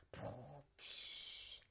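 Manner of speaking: snore, then puff out air; sleepy snore and sigh; snoring. A narrator's reading. Animals snoring in mitten.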